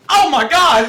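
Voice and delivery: high, loud horrified yell; over-the-top screamed disbelief; mock horror. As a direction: shout it like a terrified B-movie extra. Acting horrified movie characters.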